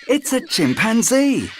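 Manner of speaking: put-on high, delighted child; a child spotting a chimpanzee; high boy's voice. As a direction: point it out like an excited boy. Jim voiced.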